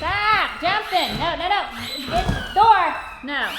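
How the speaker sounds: firm loud commands; scolding dogs. Real, not acted. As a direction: tell the dogs off sharply.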